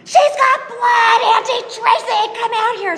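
put-on high, loud, slow alarmed yell of a little boy; mock child's frantic shout; panicked child shouting. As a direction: call for help in a panic. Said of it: "The little brother says".

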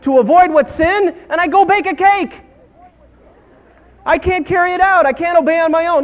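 high, loud pulpit cry; exasperated fervor, voice pushed high; impassioned preaching. Real, not acted. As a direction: preach it at full force. Preaches at full force.

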